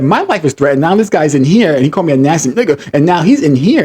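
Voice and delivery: high, worked-up complaint; exasperated, insistent retelling; retold indignant protest. Retelling his indignant confrontation.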